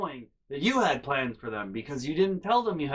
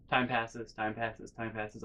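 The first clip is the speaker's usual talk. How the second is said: each repeat softer and softer; fading away; 2 semitones lower, 6 dB quieter, slower than usual. Acted. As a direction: let it fade out. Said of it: "repeated phrase fading away".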